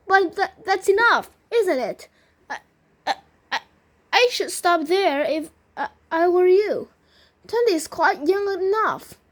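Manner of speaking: high, loud, stammering plea to stop; acted alarm with halting repeats; worried urging. A narrator's reading. Alarmed relative urges stopping.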